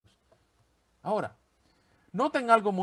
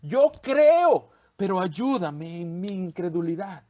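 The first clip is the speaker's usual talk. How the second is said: desperate exclamation; 3 semitones higher, 5 dB louder, slower than usual. Acted. A desperate father crying out to Jesus for help. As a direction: cry out to Jesus, pleading. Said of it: "exclamó".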